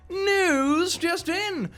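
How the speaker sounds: high, slow breaking-news announcement; dramatic breaking-news voice; news announcer. Acted. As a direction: announce it like a bulletin.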